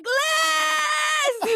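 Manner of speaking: very high, panicked-sounding retold scream; ear-splitting shout. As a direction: shriek the friend's name down the phone. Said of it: "She just blew out all our listeners' ears".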